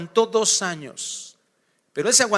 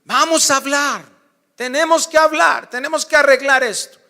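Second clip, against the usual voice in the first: loud, higher, pressing demand; insistent wife; 8 semitones higher, 8 dB louder than usual. Acted. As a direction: push hard, we must talk now. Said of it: "Les encanta hablar las esposas".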